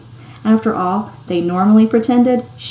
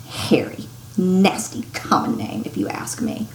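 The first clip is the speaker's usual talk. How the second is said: disdainful; 5 semitones lower, 4 dB quieter than usual. Acted. Petunia disdainful about nephew.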